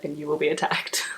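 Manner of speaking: a hushed, spooky little whisper of a warning; teasing whispered warning; mock warning whisper. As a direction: drop to a whisper like telling a secret threat. Dramatic warning aside to viewers.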